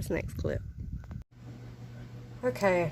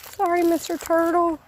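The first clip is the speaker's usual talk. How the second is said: high, loud sing-song sorry; high cooing apology; 10 semitones higher, 7 dB louder than usual. Real, not acted. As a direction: coo it up high like to a pet. Apologising to fleeing turtle.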